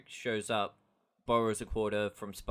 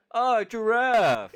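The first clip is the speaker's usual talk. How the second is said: much higher, louder, slow dopey cry; dumb Patrick; 12 semitones higher, 10 dB louder, much slower than usual. Retold or imitated. Host imitating Patrick naming the elephant wrong, dopey shout. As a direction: bellow it like a delighted dope. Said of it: "Patrick goes".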